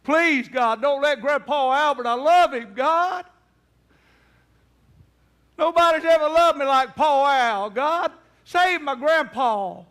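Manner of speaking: high, loud, pleading sob-prayer; crying prayer. Retold or imitated. Grandson begs God to save grandpa, crying loud. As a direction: beg God through tears. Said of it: "began to cry and beg God".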